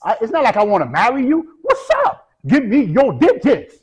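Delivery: high, loud, insistent mock come-on; pushy. Girl in comedy video dismissing his excuse, pushy. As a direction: pitch leaps up, then a stretched-out demand. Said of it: "more pushy".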